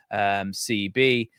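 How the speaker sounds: ordinary talk, nothing marked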